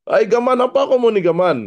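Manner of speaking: loud, high, fast Sabah market Malay; Sabah street talk. Shown as a demonstration. Preacher voicing Jesus in loud Sabah street slang. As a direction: call out to a friend Sabah-style. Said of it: "itu kalau bahasa Sabah".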